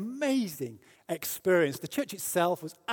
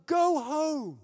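high shouted call, a touch louder; retold crowd shout; 12 semitones higher, 4 dB louder than usual. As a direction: shout it like the whole congregation joining in. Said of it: "a whole chorus of voices started shouting".

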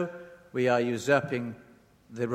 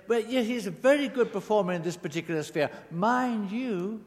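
higher-pitched praise left hanging on a doubtful rise; faint praise; 6 semitones higher than usual. Acted. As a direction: praise them, but leave a question mark hanging. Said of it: "leaves a question mark hanging in the air".

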